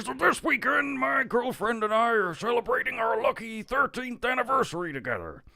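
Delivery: high voice building up; rising wrestler voice. Acted. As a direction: start building toward a shout. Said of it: "and then rising".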